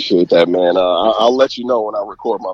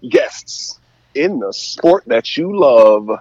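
announcer voice; 7 semitones higher than usual. Shown as a demonstration. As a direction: hype the crowd like a goal call. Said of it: "I just got chills listening to that".

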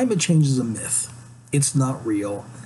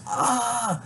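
ordinary talk

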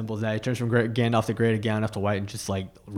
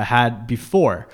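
normal talking voice

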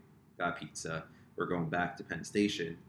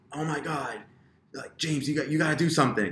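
excited; 7 semitones higher, 5 dB louder than usual. Retold or imitated. Sisters quoted urging him.

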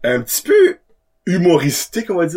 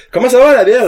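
loud greeting; 9 semitones higher, 6 dB louder, faster than usual. Real, not acted. Host greets guest loudly.

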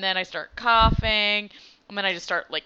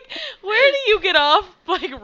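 mock outrage; 12 semitones higher, 9 dB louder than usual.

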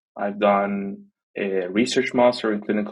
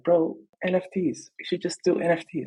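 higher-pitched, lightly voiced imitation of the friend; friend's excited pitch; 8 semitones higher than usual. Friend urging him to try NFTs, imitated pitch. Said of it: "he was like".

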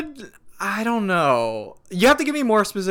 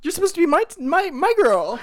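higher, hammed-up betrayal; mock betrayed friend; 12 semitones higher than usual. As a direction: whine like a betrayed best friend. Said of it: "let's roleplay this".

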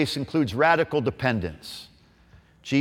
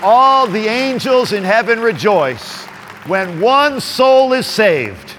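preaching; 7 semitones higher, 4 dB louder, slower than usual. Acted. Proclaiming scripture, slow and loud.

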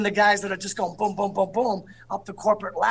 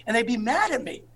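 ordinary talk, nothing marked

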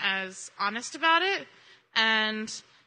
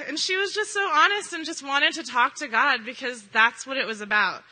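ordinary talk, nothing marked

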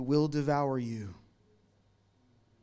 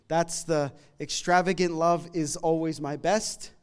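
ordinary delivery

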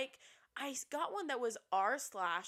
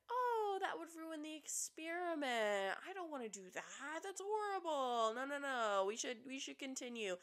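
mock nice letdown; 5 semitones higher, 4 dB quieter than usual. Host mimics a man politely refusing his ex, mock gentle letdown. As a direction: imitate someone letting a person down easy. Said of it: "to try and be nice, he said".

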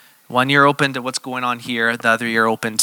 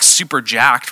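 ordinary talk

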